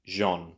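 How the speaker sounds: Jean said alone as "zhon"; French first syllable. Shown as a demonstration. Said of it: "Sounds rather French".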